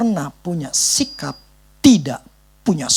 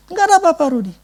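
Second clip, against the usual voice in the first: high brush-off; dismissive pastor; 11 semitones higher, much faster than usual. Retold or imitated. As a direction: toss it away high and airy. (pendeta menepis dengan nada tinggi)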